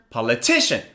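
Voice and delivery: "Politician" in one go, TI stressed; quick "Politician" peaking on the third syllable. Shown as a demonstration. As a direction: say "Politician" as a whole word, leaning on TI.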